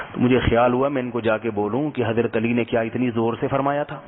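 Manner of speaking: ordinary talk, nothing marked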